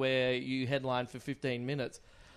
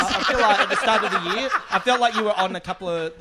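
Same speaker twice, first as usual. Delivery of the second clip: louder, laughing through it; 5 semitones higher, 7 dB louder than usual. Real, not acted. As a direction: giggle the words out. Laughs while talking.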